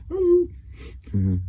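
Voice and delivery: squeaky high falsetto hello; a second personality popping out; split personality voice. Shown as a demonstration. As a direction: flip suddenly into a tiny high voice. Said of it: "That's my second one".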